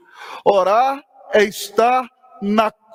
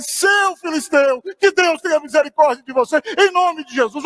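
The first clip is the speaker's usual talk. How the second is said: mock traffic outburst; 4 semitones higher than usual. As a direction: scold the driver with churchly indignation. Acted outburst at a driver. (xingamento santo e indignado)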